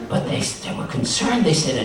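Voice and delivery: ordinary delivery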